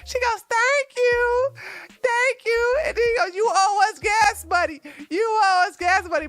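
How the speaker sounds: high, loud, laughing through the words; can barely talk for laughing; laughing retelling. Real, not acted. Retelling the clip while laughing.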